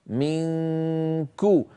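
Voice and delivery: منكو drawn out very slowly, the nasal hum held before a crisp كو; model recitation, ikhfa. Shown as a demonstration. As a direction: slowly hold the nasal of منكو, then land on كو. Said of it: "فلما أصل إلى قولي كو عندئذ أضغط".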